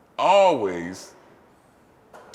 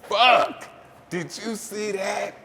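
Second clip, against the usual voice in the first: much higher, stunned half-voice; rattled rivals; 9 semitones higher, much slower than usual. Retold or imitated. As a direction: sound shaken and impressed. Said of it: "competitors going".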